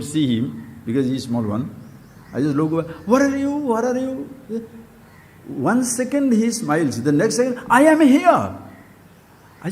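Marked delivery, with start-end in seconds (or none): 3.1-4.3 teasing hide-and-seek call; playful calling. Retold or imitated. Playing hide and seek with child. 7.7-8.6 put-on higher, louder little boy popping up; excited child announcing himself; child's voice. Voicing the small child.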